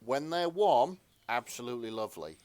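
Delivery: ordinary talk, nothing marked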